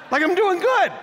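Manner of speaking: high, laughing self-praise; laughing boast. Real, not acted. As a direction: laugh it off, proud. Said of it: "I have yet to call Bethany my sister".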